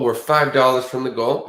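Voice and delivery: ordinary talk, nothing marked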